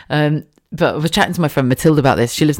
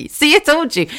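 much higher, cheeky told you so; teasing told-you-so; 12 semitones higher than usual. Retold or imitated. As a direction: gloat playfully. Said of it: "It's like".

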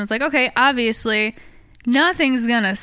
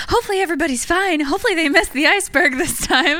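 hopeful inner voice; 7 semitones higher than usual. Retold or imitated.

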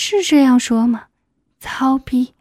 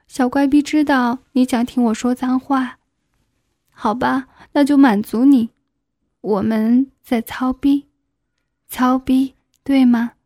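ordinary delivery, nothing marked

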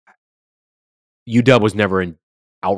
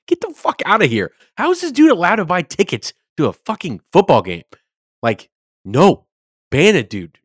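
high, loud disbelieving rant; fired-up fan venting at the stadium ban; incredulous outrage; 11 semitones higher, 8 dB louder than usual. Real, not acted. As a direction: rant in disbelief, much higher and louder. Outraged at staffer attending game.

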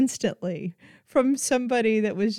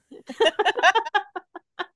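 bursts into a high, delighted laugh; can't hold back a squealing laugh; big high laugh; 12 semitones higher, 4 dB louder than usual. Real, not acted. Podcast host recalling her favourite moment, high laugh.

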